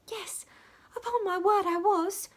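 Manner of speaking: put-on high, loud little girl's voice; small servant answering Dick, upon her word; small servant's earnest reply. A narrator's reading. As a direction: insist it's true, wide-eyed. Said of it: "replied the small servant".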